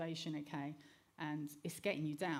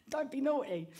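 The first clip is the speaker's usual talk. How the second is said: higher, louder mock scolding of a child; scolding mum; 7 semitones higher, 6 dB louder than usual. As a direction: tell off the toddler sharply. Mother scolding little son.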